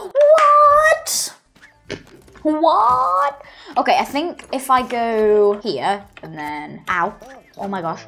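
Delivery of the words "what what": high, loud, disbelieving what; shocked what. Real, not acted. Player baffled by the game, a high shocked what. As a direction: react like it can't be true.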